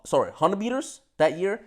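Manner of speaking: ordinary delivery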